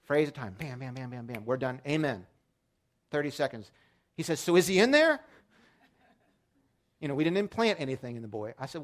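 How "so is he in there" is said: put-on higher, faster small-boy voice; lightly voiced worried child; a hint of an anxious five-year-old; child's question. Son asks, voiced higher.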